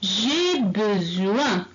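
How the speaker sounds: J'ai besoin, each syllable drawn out slowly; slow, clear teacher demonstration; slow model reading. Shown as a demonstration. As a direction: read it slowly for the class to copy. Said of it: "Répétez".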